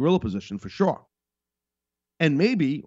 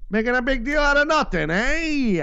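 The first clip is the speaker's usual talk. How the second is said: put-on much higher, louder old-time barker call; carnival barker; 12 semitones higher, 4 dB louder than usual. Commentator hams up critics' line as a carnival barker. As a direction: sell it like a twenties carnival barker. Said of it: "like a carnival barker guy from the twenties".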